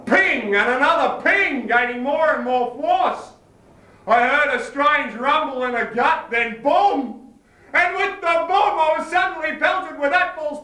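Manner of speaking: loud, rising frantic storytelling; escalating high-pitched excitement; building frenzy. Acted. Vision builds to explosive climax.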